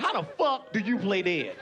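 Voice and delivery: usual speaking voice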